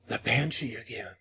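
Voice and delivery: softly, barely above a breath; faint. A narrator's reading. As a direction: say it faintly, drained. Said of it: "said Moya faintly".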